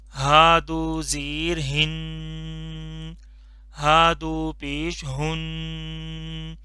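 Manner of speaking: very slow, drawn-out drill for repeating; slow drilled recitation. Shown as a demonstration. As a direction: stretch each syllable for the class to echo. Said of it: "मैं पढ़ता जाऊंगा". (बहुत धीमे, खींचकर पढ़ाना)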